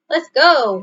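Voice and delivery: loud, high cheer; shouted. A narrator's reading. Bear shouting as he pushes the sled off. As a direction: call out as the sled starts. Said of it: "he shouted".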